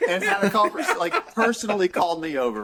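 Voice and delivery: can't keep a straight face telling it; giggling disbelief at the memory; laughing. Real, not acted. Laughing while retelling the story.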